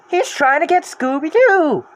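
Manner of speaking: loud, high, slow alarm; alarmed exclaim. Acted. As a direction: cry it out in worry. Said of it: "Daphne exclaimed".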